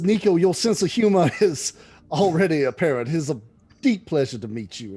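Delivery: amused, charming monk greeting a joker; laughing abbot. Acted. Abbot answers Nico's joke.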